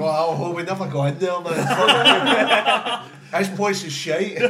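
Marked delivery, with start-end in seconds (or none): 1.4-4.5 slow, loud and higher, laughing through the words; can barely get it out for laughing. Real, not acted. Laughing at the bouncer joke.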